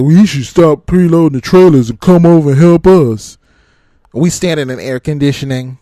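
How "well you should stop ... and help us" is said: louder, put-on voice of the impatient drivers; mock demanding trucker. Imitating the drivers who want the loader to stop, loud and pushy. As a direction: demand it like an entitled coworker. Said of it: "It was like".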